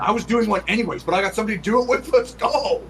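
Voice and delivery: high and loud, fast; eager friend bursting in to join the party; hyped-up bro. Acted. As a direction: burst in like a party bro who heard all-nighter. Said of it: "He goes".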